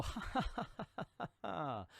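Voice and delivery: high mocking villain's cackle; villain laugh. Fleeing criminal taunting Green Arrow with a high mocking cackle. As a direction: cackle like a comic-book crook.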